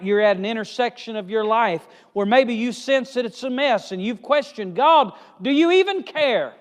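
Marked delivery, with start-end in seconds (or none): 4.7-6.5 louder, higher questioning of God; doubting cry to God. Acted.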